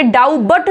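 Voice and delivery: डाउबट with a clear b; wrong pronunciation demo. Shown as a demonstration. Teacher repeating the mistake, mispronounced. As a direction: split it as "dow-but". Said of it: "ऐसे बी कहीं तक आवाज नहीं आएगी".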